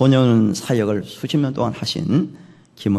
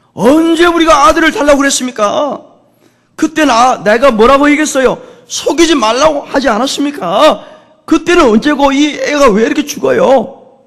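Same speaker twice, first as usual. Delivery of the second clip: high, loud, acted-out accusation; enraged outpouring; 11 dB louder than usual. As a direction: rail at the prophet as a bereaved mother. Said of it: "막 화가 나서 분노가 일어나 퍼붓기".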